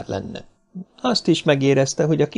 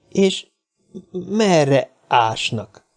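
slow, halting question, pitched up at first; frightened hesitation; 6 semitones higher, much slower than usual. A narrator's reading. Little man asking where they dig, fearfully hesitant. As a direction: drag the words out nervously. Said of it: "megrémült a kis ember a csontok hallatán".

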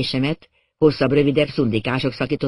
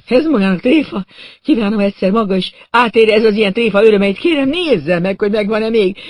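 high, loud, wailing reproach; indignant outburst; 6 semitones higher, 5 dB louder than usual. A narrator's reading. Injured man begging to be examined, indignant. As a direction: protest loudly, then plead in a high voice.

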